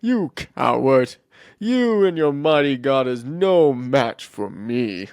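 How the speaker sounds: slow, drawn-out giant's taunt; mocking Goliath. Giant making fun of the scared army, slow mockery. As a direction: sneer grandly at a feeble opponent. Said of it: "Goliath was just making fun of them".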